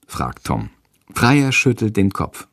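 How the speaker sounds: ordinary delivery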